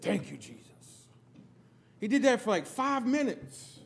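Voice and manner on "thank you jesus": soft, rapt murmur of a man lost in prayer; a hint of hushed devotion; trance prayer. Praying father-in-law, eyes closed, in a hushed trance. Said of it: "Holy Ghost trance".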